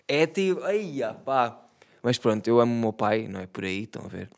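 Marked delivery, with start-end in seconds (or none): none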